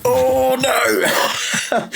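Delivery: high, loud "oh no" of playful horror; mock dismay. Mock dismay at guest's update news.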